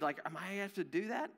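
ordinary delivery

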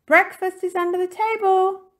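high, loud announcement; calling out. A narrator's reading. Missus Topsy-Turvy announcing breakfast, calling out high and loud. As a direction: call the family to a meal. Mother calls family to breakfast.

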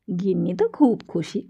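plain reading voice

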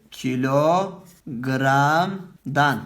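kilogramdan drawn out very slowly; slow, careful dictation of kilogramdan; slow demo. Shown as a demonstration. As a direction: say kilogramdan syllable by syllable. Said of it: "Nasıl telaffuz ettim?".

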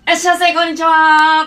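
high, loud store greeting; shop greeting call. Retold or imitated. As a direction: sing out the welcome like staff. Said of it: "ってやってたら".

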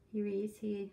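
usual speaking voice

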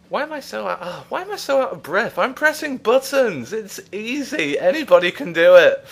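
comic winded indignation; exasperated. Real, not acted. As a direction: protest in a high voice at your own breathlessness. Mock-indignant at being winded.

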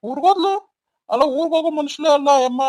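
loud, high-pitched disbelief; astonished. Acted. Husband hears his wife left for her village, astonished. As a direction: blurt it out, taken aback.

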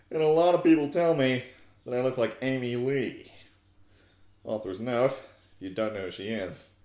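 put-on mopey teen goth narrating her own fanfic; goth girl voice. Comedian reads My Immortal aloud as Ebony, mock-goth. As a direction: read it as a self-serious edgy teen girl.

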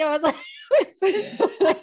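high laughing burst; laughing through the line. Real, not acted. Laughing at own story.

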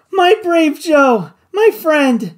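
high, loud and slow, overcome with feeling; deep emotion. A narrator's reading. Doctor thanking Joe for saving them, moved and slow. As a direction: pour out gratitude, voice full. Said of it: "said the doctor with deep emotion".

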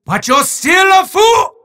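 booming sore-loser wizard, shouting his protests; a theatrical villain ranting at the player; hammy evil wizard, outraged then mocking; gloating wizard villain.

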